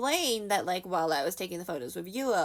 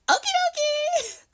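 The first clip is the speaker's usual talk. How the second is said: very high, loud squeal; hammed-up chirpy consent; retold cheery agreement; 8 dB louder, slower than usual. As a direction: sing out a drawn-out, squeaky yes. Imitates idol agreeing to pose.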